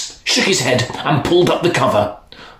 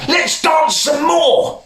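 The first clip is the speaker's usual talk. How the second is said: put-on high, slow pleading child; begging prince. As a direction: beg like an eager little boy. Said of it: "who begged".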